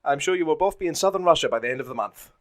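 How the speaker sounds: lightly hammed officer brush-off; generic Nazi voice. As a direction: dismiss them with mock politeness. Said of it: "my generic Nazi voice".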